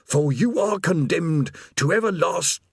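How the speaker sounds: portentous proclamation cut short; doom-laden demon. A narrator's reading. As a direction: pronounce damnation, then break off mid-word.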